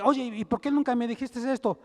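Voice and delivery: husband demanding why nobody told him; husband shouting. Retold or imitated. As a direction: yell it like a betrayed husband. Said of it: "el esposo grita y dice".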